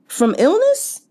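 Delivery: high, disbelieving question; can't believe what you just read; shocked rising "from illness?"; incredulous. Real, not acted. Incredulous at a character's death.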